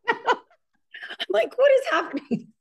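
high laughing "what is happening"; laughing disbelief. Real, not acted. Host laughing as her dog throws up. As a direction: laugh through the question.

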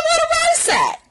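very high-pitched incredulous squeal; sky-high comic protest; indignant outburst. Retold or imitated. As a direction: squeal the question in mock outrage. Acted indignant question about rice.

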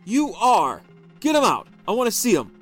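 high, loud angry shouting; husband demanding his wife hand over papers. A narrator's reading.

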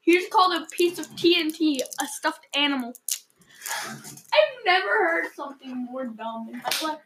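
ordinary talk, nothing marked

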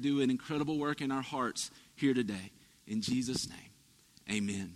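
soft, hushed pastor's prayer; a pastor praying gently over his church; quiet prayer. Acted. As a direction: pray over the congregation, softly. Leads a prayer.

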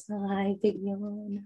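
slow drawn-out coo; puppy talk. Real, not acted. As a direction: coo softly at the yawning pup. Cooing at yawning puppy.

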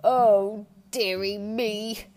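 loud first word, then drawn out; lightly hammed-up woe, slow; mock distressed king. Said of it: "The King sobbed".

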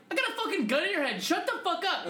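high, urgent mock threat; threatening robber. Friend retelling the robbery video, mock threatening. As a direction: imitate an armed robber giving orders.

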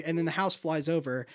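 ordinary delivery

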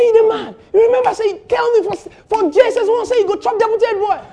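high, loud acted Pidgin scolding; indignant outburst. Friend reminding him of his old boast, indignant outburst. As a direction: challenge a friend excitedly, pitch held high.

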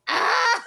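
high, loud mock scream. Friend imitating the witches' fright at the bus. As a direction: shriek like scared witches. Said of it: "bitches like".

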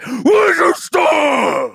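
high, drawn-out scream imitating a snap; acted sudden outburst, stretching the words; explosive meltdown shout. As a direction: blow up into a full-voiced, high-pitched yell. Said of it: "it went literally".

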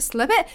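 "slip it?" said as two words with a questioning rise; testing the odd word "slip it" aloud, unsure; puzzled repeat. Real, not acted. Podcaster puzzling over a title, questioning rise.

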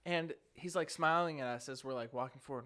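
ordinary delivery

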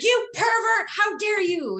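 high-pitched theatrical indignation; mock outrage. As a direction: shriek it like a scandalized wrestling heel. Re-enacts her outraged heel act.

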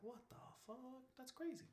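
high, very soft mock disbelief; hushed amazement. Retelling reaction to sped-up cartoon voices, hushed high amazement. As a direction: squeak it quietly, as if mind blown.

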